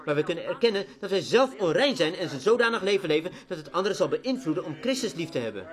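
ordinary talk, nothing marked

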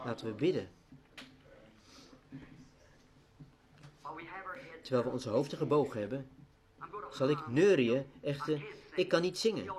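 bow heads and speak gently; quiet, reverent altar call; hushed prayer. Acted. Leads closing prayer softly.

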